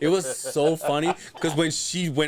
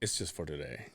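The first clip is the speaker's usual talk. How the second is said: very soft, low aside; retold whisper; 12 semitones lower, 15 dB quieter than usual. Son re-enacting his aside in the car video, hushed. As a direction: drop to a hushed, confiding near-whisper. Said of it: "I, I whisper".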